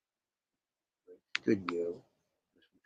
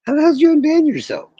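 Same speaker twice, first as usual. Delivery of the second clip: disbelieving yell, much higher and louder; shocked shout; 13 dB louder than usual. Real, not acted. Shocked at a chat user.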